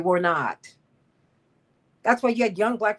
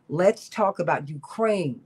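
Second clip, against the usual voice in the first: ordinary talk, nothing marked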